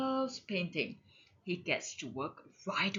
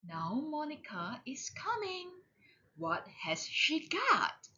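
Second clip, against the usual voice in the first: usual speaking voice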